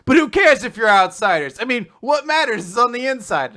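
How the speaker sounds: high, loud, impassioned reassurance; hammed-up plea for acceptance; earnest protest. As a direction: push it out with theatrical conviction. Acted earnest protest of a character.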